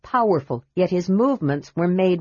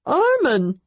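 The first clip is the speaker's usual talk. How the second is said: joyful greeting; 8 semitones higher than usual. A narrator's reading. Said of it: "Tass greeted him with joy".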